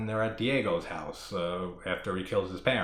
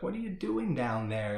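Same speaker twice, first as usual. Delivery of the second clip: put-on higher, sweet little-girl voice, faking innocence; lightly voiced innocent little girl; mock innocent girl; 7 semitones higher than usual. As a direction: play the innocent child luring her out. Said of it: "acting all innocent".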